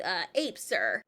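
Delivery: a dopey cop answering his boss, low; mimicking the cop. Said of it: "the cop on the other end is like".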